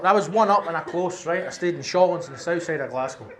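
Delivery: ordinary talk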